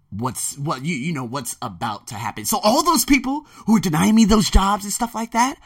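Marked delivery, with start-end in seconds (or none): 2.5-5.6 high, loud taunt; rising, fired-up taunt; fired up at those who denied him jobs; heated. Real, not acted. Calling out rejecting employers.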